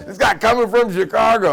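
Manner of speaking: very loud, high jeering holler; mocking announcement. Trucker tells the other truckers, hollering in ridicule. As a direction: broadcast the joke to the whole room. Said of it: "he called on the other trucker".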